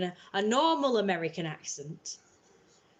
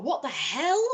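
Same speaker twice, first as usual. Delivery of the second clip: retold bewildered outburst, higher pitch; baffled disbelief; 6 semitones higher, 3 dB louder than usual. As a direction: recall your astonished reaction, pitch lifted. Retold baffled reaction to accents.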